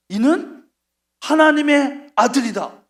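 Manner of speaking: loud, slow, awed proclamation; centurion's declaration. Acted. Roman centurion at the cross, loud awed proclamation. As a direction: proclaim it like a stunned soldier. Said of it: "이렇게 한 거예요".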